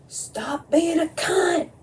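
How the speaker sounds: high, slow mock yell out the window; imagined holler. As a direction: stretch it out like yelling at the neighbours. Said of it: "I damn near hollered out my window".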